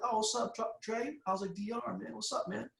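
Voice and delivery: high, soft, overly casual hello to the boss; nervous greeting. Retold or imitated.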